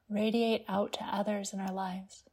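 ordinary talk

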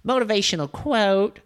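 high, loud, hammed-up American voice; mock American motivational voice; exaggerated American accent. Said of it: "speaking the accent as American".

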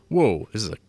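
usual speaking voice

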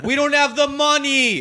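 high, slow mock yell in a New York accent; angry New York yell. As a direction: yell at your girl, New York style. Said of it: "when you get mad".

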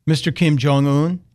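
ordinary talk

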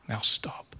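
hushed, voiceless tender command; whisper. Acted. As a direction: whisper it gently to still them.

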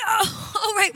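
high, tearful wail; mock sobbing as she explains; imitating crying. Friend voiced crying while making an excuse. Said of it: "she's crying".

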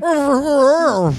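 high, loud garbled British bluster; Nigel Thornberry. Acted. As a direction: blurt a mumbled British cartoon noise. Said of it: "British Thornberry accent".

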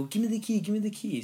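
high, urgent mock demand for the key; eager demand. Friend at escape room demanding the key, eager and high.

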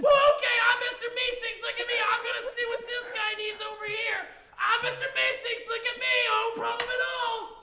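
bubbly high helper voice; Mister Meeseeks. Acted. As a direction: cheerfully take the job like an eager servant. Meeseeks agrees to help.

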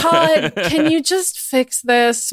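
loud call of the name, then a slow, worn-out plea; exhausted. Acted. Maddie begs Todd to fix it, exhausted. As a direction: sound completely done with this. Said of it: "Maddie asked in an exhausted tone".

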